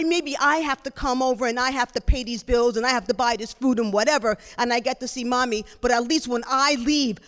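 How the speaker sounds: loud, bitter retort; heated comeback with punched words; infuriated. Retold or imitated. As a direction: lash back at a sibling to wound. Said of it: "I'm just infuriated".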